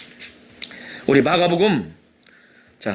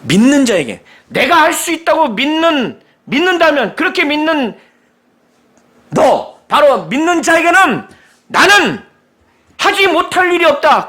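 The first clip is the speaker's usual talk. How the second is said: fervent preaching; 8 semitones higher, 5 dB louder than usual. Acted. Preacher proclaiming Jesus' words to the church, loud and fervent. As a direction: drive the promise home to the congregation.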